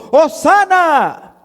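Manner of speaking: high, drawn-out cry of praise; crowd's jubilant shout. Acted. Crowd hails Jesus on the road, jubilant shout. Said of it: "daban voces diciendo".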